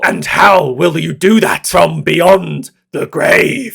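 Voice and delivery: high, slow, booming mad-scientist villain; over-the-top villain voice. Acted. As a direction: ham it up like a mad villain, building to a shout. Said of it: "in a very over-the-top villain voice".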